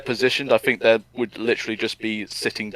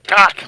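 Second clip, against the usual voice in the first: choked cry; 9 semitones higher, 5 dB louder than usual. Retold or imitated. Mimicking king changing.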